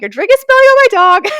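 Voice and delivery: urgent shriek repeating the warning. Retold or imitated. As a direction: scream it again, nearly losing it.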